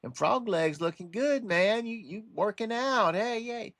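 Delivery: high, sing-song flattery; schmoozing scorpion. Acted. Scorpion sweet-talking a frog for a ride, schmoozing. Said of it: "some schmooze in there".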